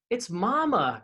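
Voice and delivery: high, startled exclamation; shocked cry. A narrator's reading. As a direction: gasp it out in shock. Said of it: "cried Hansel in shock".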